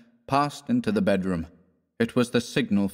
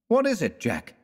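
cried out; 10 semitones higher than usual. A narrator's reading. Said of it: "cried West".

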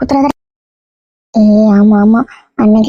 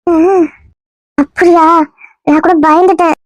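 a lightly put-on girl's voice, hurt and coy; woman's voice; 3 semitones lower than usual. Disguised man plays the girl wooed by the husband. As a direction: play the wronged young woman, only lightly feminine. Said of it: "இன்னும் பொம்பளை குரல்ல தான் பேசுற".

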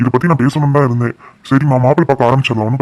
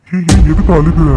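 startled, louder and higher; sudden worried raised voice; alarmed concern; 4 semitones higher, 7 dB louder than usual. Acted. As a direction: jolt up in worry as she starts crying. Husband sees wife crying.

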